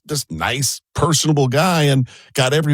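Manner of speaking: ordinary delivery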